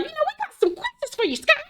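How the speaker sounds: very high, soft and quick; mock sweet voice. Friend springs the questions on Scott, mock sugary and soft. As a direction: sing it out sweetly like a trap. Mocking Heavenly starting the intervention.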